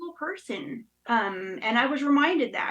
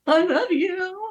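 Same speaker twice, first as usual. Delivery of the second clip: mock sobbing gasp; 8 semitones higher, slower than usual. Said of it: "sobbing, ridiculous, hyperventilating".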